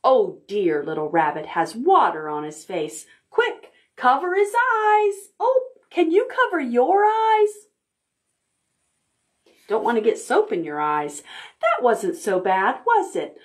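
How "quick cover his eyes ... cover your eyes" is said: much higher, playful alarm; mock urgent. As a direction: hurry them along playfully.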